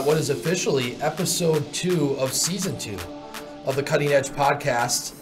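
ordinary talk

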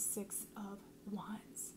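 slow, hushed whisper. Real, not acted. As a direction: let the card name out as a secret. Reveals the card secretively.

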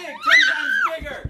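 very high, very loud scream; huge shout. Real, not acted. Dad cheering the kids on, screaming at the top. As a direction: yell it to the rafters. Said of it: "Let it, and big".

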